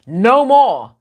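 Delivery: loud, firm proclamation voicing China; loud defiant warning; defiant declaration. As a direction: announce it like a nation drawing a line. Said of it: "China is finally saying".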